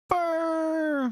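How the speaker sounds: high excited yelp of a name; quick high shout of delight; excited call-out. Real, not acted. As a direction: call it out, thrilled. Calling out at a furry.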